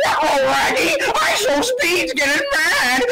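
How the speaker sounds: high, drawn-out cartoon dog voice; Scooby-Doo. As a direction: whine it out like a goofy talking dog. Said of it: "Shaggy from Scooby-Doo".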